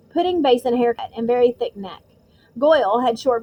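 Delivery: ordinary delivery, nothing marked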